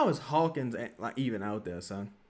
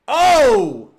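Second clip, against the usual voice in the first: 크게 터지는 놀란 외침; a startled loud yelp at the play; sudden shout; 9 semitones higher, 16 dB louder than usual. Real, not acted. Reacting to a big play.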